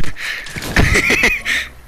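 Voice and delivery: risita muy aguda y chillona; risita traviesa. Real, not acted. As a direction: soltar una risa aguda, traviesa. Se ríe tras expulsar a alguien. (risita chillona de travesura)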